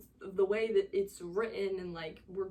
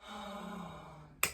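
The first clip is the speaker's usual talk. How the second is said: ordinary delivery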